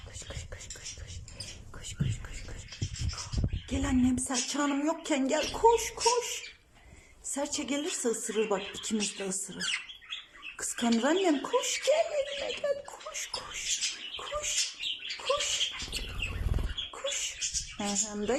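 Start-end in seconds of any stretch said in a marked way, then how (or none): 0.1-3.7 soft whispered run run; whispered coaxing. Real, not acted. Owner coaxing the bird over, whispered. 11.4-17.4 very high playful coaxing. Real, not acted. Owner luring the bird onto her hand, high coaxing.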